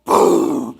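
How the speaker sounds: loud pum sound effect; mimicking the turtle's fall; loud thud. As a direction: slam out a crash sound. Turtle hits the ground.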